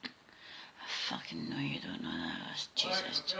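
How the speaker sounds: hissed under the breath; exasperated whisper. Real, not acted. Fed-up owner whispering curses at Alexa. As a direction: seethe it quietly at the machine. Muttering curse at the smart speaker.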